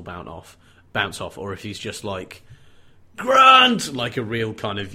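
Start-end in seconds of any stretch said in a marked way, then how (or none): 3.2-3.9 high, loud imitated cry of fear; frightened mock shout; fearful yell. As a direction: yell the name in acted panic. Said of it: "really fearful kind of".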